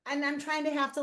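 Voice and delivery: ordinary talk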